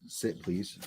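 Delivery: softly asking the dog to sit; soft request. Real, not acted. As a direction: ask gently, almost hushed. Soft polite command.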